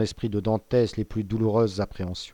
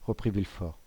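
usual speaking voice

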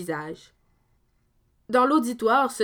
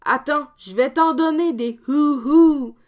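loud, higher mocking threat; threatening Dominique; 4 semitones higher, 7 dB louder than usual. Dominique threatening the fake ghost, loud mock hoot. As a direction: let him have it, mocking the hoot. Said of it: "dit Dominique".

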